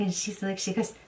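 normal talking voice

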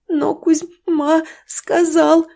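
high, slow, choked with tears; frightened wife in tears, high and slow; tearful stammer. Acted. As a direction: stammer it out through sobs. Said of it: "Обливаясь слезами, Ирина тоже начала заикаться".

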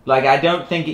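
normal talking voice

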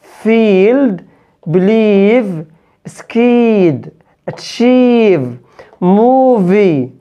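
فيلد said slowly with a long stretched "ee"; long English ee. Shown as a demonstration. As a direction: hold the long "ee" in فيلد، بليف، سكيد، أتشيف، موفي. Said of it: "بنطقها إي". (إي طويلة ممدودة ببطء)

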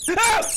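short high yelp; loud, high shriek of fright; startled scream. Real, not acted. Player hit by a jump scare, screaming.

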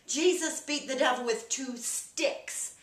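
slow, loud, drawn-out explanation; emphatic correction. Retold or imitated. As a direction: spell it out for someone who misheard. Corrects wife, spelling out the slogan.

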